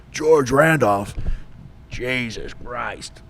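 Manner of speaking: slow, drawn-out gruff disbelief; slow drawn-out sergeant. Retold or imitated. Sergeant hearing the name over the phone, slow and drawn out. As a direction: stretch it out like a grizzled old sergeant.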